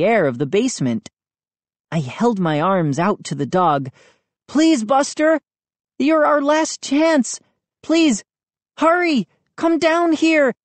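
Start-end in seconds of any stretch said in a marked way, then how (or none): 4.5-10.5 high, urgent begging; desperate pleading. A narrator's reading. Trapped boy begging his dog, desperate plea. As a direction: beg as if it's the last chance.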